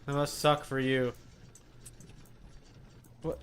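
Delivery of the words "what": ordinary talk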